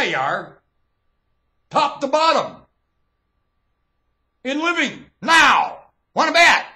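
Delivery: loud, high, hammering warning; a preacher's angry thundering warning; fierce rising rant. Real, not acted. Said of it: "You hear my tone of voice?".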